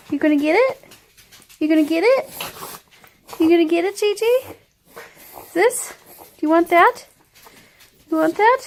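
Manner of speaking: high, loud coaxing dog voice. Real, not acted. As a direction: coax the dog in a squeaky voice.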